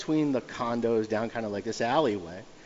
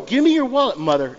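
higher, louder mock thug demand; loud mock mugger voice; mugger's demand; 10 semitones higher, 7 dB louder than usual. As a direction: bark it like a street tweaker. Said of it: "I'm like".

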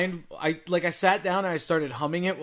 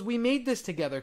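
imitated child; 7 semitones higher, 3 dB quieter than usual. Voicing his young son.

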